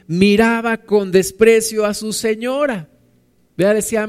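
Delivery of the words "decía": ordinary talk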